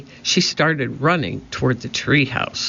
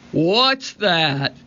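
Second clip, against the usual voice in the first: higher, startled pirate call; shouting; 4 semitones higher than usual. A narrator's reading. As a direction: demand it in a mild, drawn-out shout. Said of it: "he shouted".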